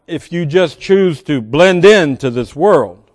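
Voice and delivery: normal talking voice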